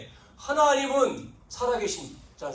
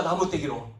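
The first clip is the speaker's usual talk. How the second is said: normal talking voice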